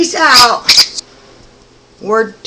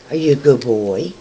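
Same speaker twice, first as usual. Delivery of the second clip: ordinary talk, nothing marked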